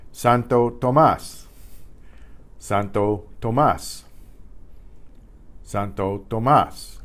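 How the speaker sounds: "Santo Tomas", slowly, with the last syllable "mas" stressed; "Santo Tomas" said slowly, accent on "mas". Shown as a demonstration. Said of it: "you hear that stress in the mas part".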